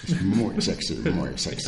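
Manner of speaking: low, soft sultry radio voice; late night radio dj. Pretend. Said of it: "con voz de radio para que la gente se pueda dormir".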